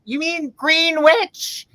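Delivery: high, slow "Greenwich" said gren-itch; old lady correcting. Retold or imitated. Old Italian lady correcting the voter, "Greenwich" as gren-itch. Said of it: "and they go".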